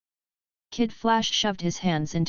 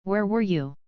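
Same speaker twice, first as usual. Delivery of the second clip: sharp and flat. A narrator's reading. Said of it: "Batman's voice was sharp, flat, and heavy".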